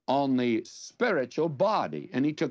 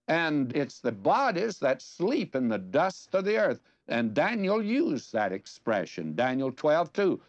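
normal talking voice

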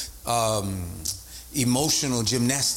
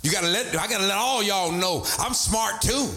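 mock raised voice; 7 semitones higher than usual. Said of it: "Your voice gets raised".

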